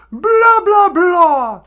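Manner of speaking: very high, very loud mock rant; hammed-up high-pitched blaring; Greta Thunberg. Activist dismisses the host, mock shouting high. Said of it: "All right, Greta".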